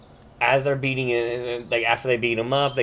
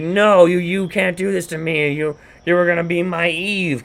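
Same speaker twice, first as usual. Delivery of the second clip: higher-pitched, mock-outraged pleading; wounded protest; 6 semitones higher, 4 dB louder than usual. Jilted man to the woman, mock-wounded protest. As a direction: protest the betrayal in a raised pitch.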